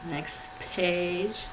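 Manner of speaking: ordinary delivery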